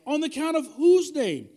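loud, high call to the church; rallying question. Real, not acted. Preacher calls out loudly to the congregation for an answer. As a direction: fire up the crowd with the question.

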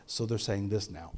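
low, soft translator's aside; hushed interpreter aside. Retold or imitated. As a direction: lean over and explain it discreetly. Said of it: "He would lean over and say".

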